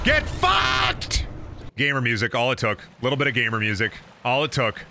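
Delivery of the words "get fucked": much higher, yelled taunt; victorious yell; triumphant shout. Real, not acted. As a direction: scream it at the beaten enemy. Triumphant yell at dead boss.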